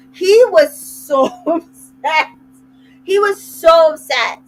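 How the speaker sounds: can't keep a straight face, slows down; laughing. Real, not acted. Host laughing while telling listeners he was upset.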